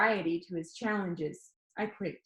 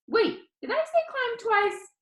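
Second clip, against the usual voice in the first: caught-out surprise; 14 semitones higher, 4 dB louder than usual. Retold or imitated. Narrator imagines Danny catching himself.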